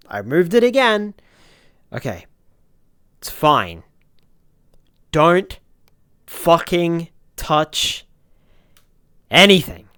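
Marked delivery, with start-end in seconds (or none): 0.1-1.1 higher, louder frustrated whine; exasperated whine. Real, not acted. Repeats, higher. 5.1-9.9 loud, slow, each word separate; frustrated warning. Real, not acted. Warns himself, loud slow.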